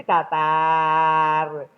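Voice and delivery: DATAR held long on one unchanging note; "datar" as a long, level drone; level monotone. Shown as a demonstration. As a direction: draw out "datar" with no rise at all. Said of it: "Nggak ada naiknya".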